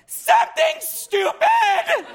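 squeaky high, slow shouted answer; manic cheerful yelp. Retold or imitated. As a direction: blurt it out in a silly high voice. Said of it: "And I'll be like".

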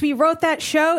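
ordinary talk

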